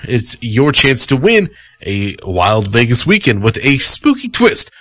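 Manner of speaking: usual speaking voice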